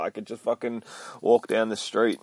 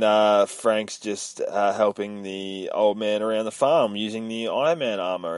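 ordinary talk

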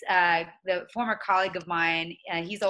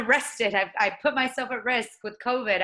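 normal talking voice

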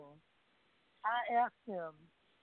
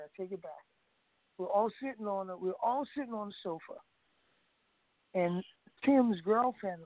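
usual speaking voice

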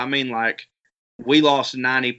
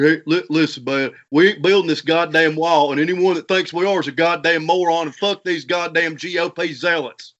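Trey Crowder impression; 3 semitones higher than usual. Shown as a demonstration. Teaching friends his Trey impression, nasal rant. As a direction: rant like a stuffed-up Doctor Phil. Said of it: "if you wanna do Trey".

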